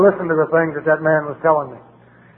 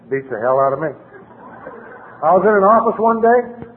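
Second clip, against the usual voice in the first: ordinary delivery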